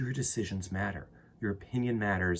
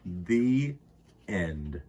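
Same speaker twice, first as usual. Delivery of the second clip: put-on unhurried read-aloud for kids; storybook reading; 3 semitones lower than usual. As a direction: read it like storytime to little ones. Reads a children's book aloud.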